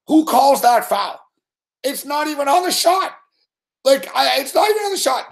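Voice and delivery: can't believe the call; outraged disbelief. Real, not acted. Outraged disbelief, pitch jump.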